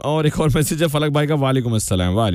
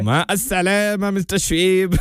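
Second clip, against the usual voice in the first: slow, high, drawn-out mock goodbye; joking send-off; 4 semitones higher, much slower than usual. Real, not acted. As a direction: wave the caller off playfully, stretching the words. Host jokingly dismisses caller's invitation.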